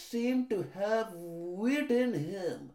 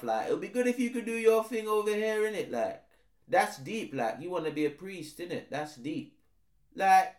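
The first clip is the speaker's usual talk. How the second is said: laid-back street slang, quick; roadman voice; faster than usual. Acted. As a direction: hype a mate up like a roadman.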